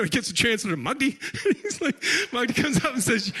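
usual speaking voice

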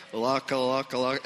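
small, plain babbled syllables; halting imitation of first attempts at tongues; tentative tongues. New believer going back to his few syllables, tentative imitated tongues. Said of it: "I go, "Okay, well, that's as good as it got".

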